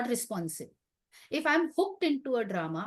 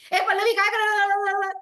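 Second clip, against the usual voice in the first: high, loud, fast shout across the house; mother's loud call; 9 semitones higher, 8 dB louder, faster than usual. Retold or imitated. Imitating her mother shouting a greeting to her at dawn, loud and fast. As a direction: bellow it like a mother calling at dawn. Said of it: "she speaks loudly only".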